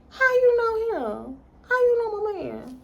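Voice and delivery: high-pitched possessive challenge; mock jealous questioning. Playing the girlfriend confronting someone about her man, mock jealous. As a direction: question them in a high, put-on voice.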